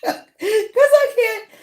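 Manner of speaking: high, slow, laughing squeal; high-pitched giggly confession; real laughing admission. Real, not acted. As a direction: laugh helplessly through the words. Laughing at own lack of know-how.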